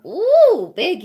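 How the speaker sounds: loud, very high squeal; surprised ooh. Real, not acted. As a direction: react to a big yawn. Puppy yawns again.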